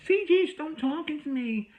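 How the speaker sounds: high, slow, put-on whiny voice; mocking whine. Mocking the friend's complaint in a high whiny voice. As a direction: mimic her whining to ridicule it.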